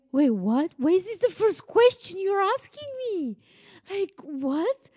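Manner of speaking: high, loud, incredulous outburst; can't believe what she's hearing; shocked disbelief. Retold or imitated. Retelling her shocked reply to the police officer, high and loud.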